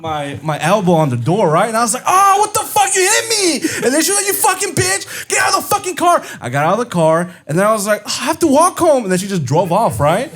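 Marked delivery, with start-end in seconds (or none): 2.1-3.6 high-pitched mock yelp of protest; indignant outcry. Boyfriend to girlfriend after his elbow hit the door, high indignant outcry. 4.3-6.2 very high mock screaming; retold scream. Girlfriend kicking him out of the car, imitated high-pitched scream. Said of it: "She was screaming at me". 8.1-9.0 high complaining wail, acted; mock dismay. Boyfriend left to walk home, high-pitched mock dismay. Retold dismay at being abandoned.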